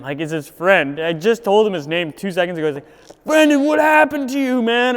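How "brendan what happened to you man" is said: high, loud imitated question; imitating a stranger. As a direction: mimic the guy's concerned question. Stranger asks Brendan, mocked.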